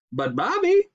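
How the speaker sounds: high, loud mock whine; mother mocking her whiny son. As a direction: mimic the kid's whining. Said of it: "Belinda mimicked her whiny son".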